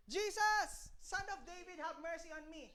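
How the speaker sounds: stage-quiet imitation of calling out; imitated blind beggar's call. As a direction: plead softly, as if calling from within a crowd. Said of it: "Tingnan pa ba yung sumigaw".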